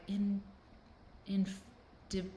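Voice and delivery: halting, piece-by-piece attempt at indefatigable; stumbling through indefatigable like a baffled reader; struggling to pronounce. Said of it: "I just couldn't do it".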